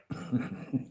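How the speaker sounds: quick high giggle; short cackle between lines; laughter. Real, not acted. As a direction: break into a high, breathy snicker. Amused by own mock insult.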